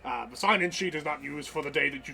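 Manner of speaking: hurried, officious British character voice; British robot barkeep. Acted. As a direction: rattle off the booking rules as a British host. Barkeep resumes explaining booking.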